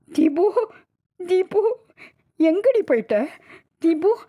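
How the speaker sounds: put-on high, tearful pleading with a dead child; choked wailing. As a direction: cry out to her, coaxing through sobs. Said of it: "நெஞ்சை அடைக்க கதறிக்கொண்டே சரிந்தாள்". (நெஞ்சை அடைக்கக் கதறுதல்)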